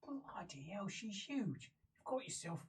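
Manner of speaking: very soft, half-whispered disbelief; hushed astonishment. Acted. Game master voicing guard seeing a filthy newcomer, hushed. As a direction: mutter it, amused, under your breath.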